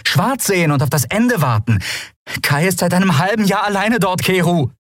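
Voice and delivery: high, heated reproach; scolding. A narrator's reading. Captain reproaching Keru over abandoned Kai, scolding. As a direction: keep scolding, pitched well up. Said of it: "keifte Andriel den Skrier an".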